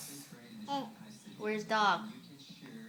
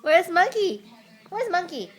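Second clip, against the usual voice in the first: loud, high, playful question; higher-pitched, eager repeated question; excited prompting; 7 semitones higher, 10 dB louder than usual. Real, not acted. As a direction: call it out brightly to get attention. Repeating the question to get child's attention.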